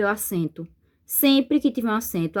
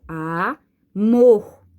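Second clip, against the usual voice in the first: amor drawn out slowly, "mor" much louder; stress on the last syllable; 6 dB louder than usual. Shown as a demonstration. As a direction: stretch amor and lean hard on "mor". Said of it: "com mais força?". (amor bem devagar, com "mor" mais forte)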